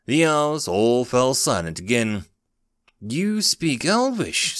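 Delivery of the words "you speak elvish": high, drawn-out squeak. A narrator's reading. Startled elf finding the human speaks Elvish, in a squeak. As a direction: squeak it slowly in amazement. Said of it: "squeaked one".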